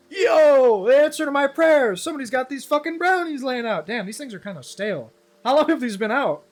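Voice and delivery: loud, high hammed-up stoner thrill; mock stoner excitement. Streamer voicing stoner who finds brownies, loud high hammed-up glee. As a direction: squeal over the free brownies as the stoner.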